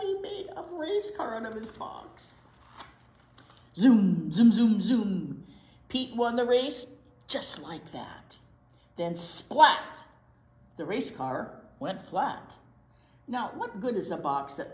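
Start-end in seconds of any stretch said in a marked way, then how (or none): 3.7-5.4 zoom punched loud like a racing car. A narrator's reading. Race car sound effect. 9.2-10.0 punchy loud splat. A narrator's reading. Crash sound word.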